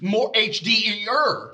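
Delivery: drawn-out mock hype; slow mock announcement. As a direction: stretch it out like a sales pitch. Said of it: "they're like".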